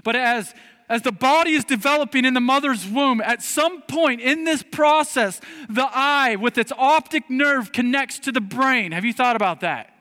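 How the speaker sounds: high, loud preacher's delivery; impassioned preaching. Acted. Preacher pressing congregation on who made their bodies, impassioned preaching. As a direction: drive it home, loud and high-pitched.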